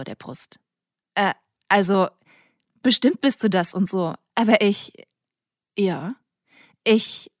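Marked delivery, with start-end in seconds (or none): 1.1-2.1 louder, hesitant, stretched-out "also"; nervous filler "also"; flustered stammer. Acted. 2.8-7.3 louder, halting, broken-off fragments; embarrassed rambling; flustered babble. Acted. Milla flustered, stammering.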